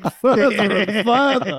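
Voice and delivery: laughing. Real, not acted. Laughing at segue.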